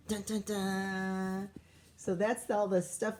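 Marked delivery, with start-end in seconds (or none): 0.1-1.5 dramatic dun dun dun. Acted. Dramatic suspense sting in read-aloud.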